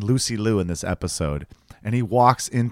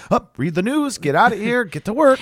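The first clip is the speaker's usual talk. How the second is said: higher, brisk self-ordering; bossy self-talk; 10 semitones higher than usual. Retold or imitated. Urging himself to work at dawn, high bossy self-order. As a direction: drill yourself out of bed, higher. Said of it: "and I'm like".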